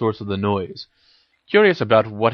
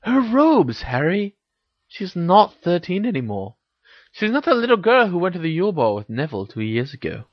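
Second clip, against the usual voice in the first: put-on higher, emphatic girl character voice; Hermione, insistent; 8 semitones higher than usual. A narrator's reading. Hermione explains to Harry that Ginny has grown, insistent. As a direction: press the obvious point, pitched up.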